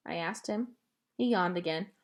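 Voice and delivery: ordinary delivery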